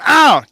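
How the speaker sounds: loud high "ow" yelp; mock pained yelp. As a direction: yelp it like you just got smacked. Imitating husband hit by wife.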